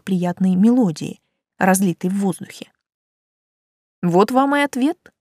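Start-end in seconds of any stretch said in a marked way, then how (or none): 4.0-5.1 lightly amused, higher voice; smirking. A narrator's reading. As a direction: say it with a small smirk. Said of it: "усмехнулась я". (с лёгкой усмешкой, повыше)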